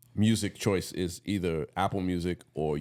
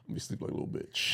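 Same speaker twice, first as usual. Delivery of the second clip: breathy whisper, half-laughing; 5 dB quieter than usual. Real, not acted. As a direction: whisper it, sleepy and amused. Tired, joking about sleeping.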